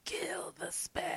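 sinister unvoiced whisper. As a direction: hiss the order in a whisper.

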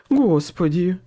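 loud, high gasp of dread; strangled frightened exclamation; choked voice. A narrator's reading. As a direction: choke it out in shock. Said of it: "произнёс Хват перехваченным голосом".

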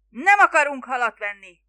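very loud, exasperated shout; roaring. A narrator's reading. Spiro refusing the fisherman's fish, roaring. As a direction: roar the refusal at him. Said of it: "ordította Spiro".

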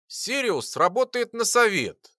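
a bit cheeky and loose. Acted. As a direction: toss it off with swagger. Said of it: "немного развязно заявила Лара".

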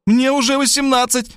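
put-on high girlish defiant shout. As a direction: fire it back proudly, high. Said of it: "закричала Варвара". (высокий девичий выкрик)

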